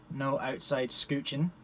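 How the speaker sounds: ordinary talk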